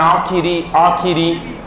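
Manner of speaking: आखिरी with every vowel sounded; careful correct form. Shown as a demonstration. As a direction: clear "khi" in each आखिरी. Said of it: "शुद्ध बोल बोल के लिखना".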